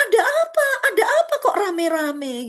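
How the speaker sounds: high, bewildered cry with a laugh; bewildered son. Acted. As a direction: sound baffled by the noise. Elder son asks about the commotion.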